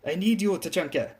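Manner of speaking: put-on high, pleading call with German accent; acted German woman's appeal; heavy German accent. A narrator's reading. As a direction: implore him in a thick German accent. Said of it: "she said with a heavy German accent".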